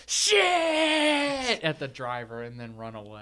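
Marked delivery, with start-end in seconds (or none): 0.1-1.6 very high, drawn-out shriek; mock scream. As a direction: screech it long, as if at a startled driver.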